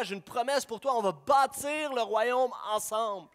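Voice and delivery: urgent, rousing preacher's call; full-force preaching. Acted. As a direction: preach it with fire, calling sinners home.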